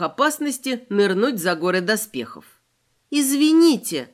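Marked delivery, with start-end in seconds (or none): 3.1-4.1 calling out louder; raised polite call; громкий зов. A narrator's reading. Зовёт спящего зверя. (громко зовёт)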